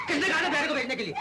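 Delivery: loud, high, outraged question; angry demand. Acted. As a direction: shout it, demanding an answer. Enraged at the man being sent.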